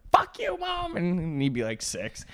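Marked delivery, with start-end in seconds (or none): 0.1-1.0 put-on very high kid's squeal; squeaky six-year-old. Friend voicing a six-year-old swearing at his mom, squeaky.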